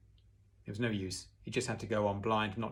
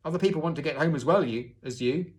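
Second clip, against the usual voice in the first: loud, high, grumbling passenger; irritated complaint from a jostled passenger; growled complaint; 5 semitones higher, 8 dB louder than usual. A narrator's reading. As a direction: snap it out fast and loud. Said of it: "he growled".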